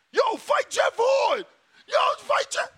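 playing fans yelling for a fight they never wanted; mock fan shout. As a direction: holler it like a fan in the crowd. Acting fans calling out Crawford.

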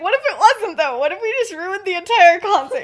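high, loud mock freak-out; retold panicked protest. Friend confronts James over broken amps, mock panicked protest. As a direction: pile up the worried questions, pitch way up.

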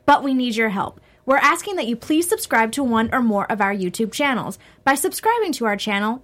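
upbeat network promo read. Acted. Reads network announcement.

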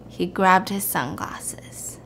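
hushed reveal. A narrator's reading. Storyteller driving the twist home to listener, hushed. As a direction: let the twist sink in quietly.

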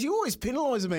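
high-pitched aggrieved whine; mock complaining player. Retelling the player griping to the referee in a high whine. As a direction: play the wounded forward, up high. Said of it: "He goes".

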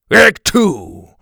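high, loud, gruff barked order. Acted. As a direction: snap it out like a cranky caveman. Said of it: "the teacher barked".

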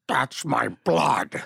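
slow, heavy reveal of a dark secret; slow ominous reveal. Retold or imitated. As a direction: let it land slowly and eerily. Retold Nick's reveal.

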